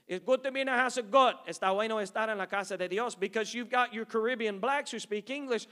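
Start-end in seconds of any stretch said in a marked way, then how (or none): 0.1-3.2 loud, quick preacher greeting; service opening. Retold or imitated. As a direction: open the service with energy. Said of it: "their service starts out something like this".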